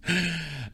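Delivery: short high chuckle; soft, high-pitched laugh. Real, not acted. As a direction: chuckle to yourself. Recalls the show, amused.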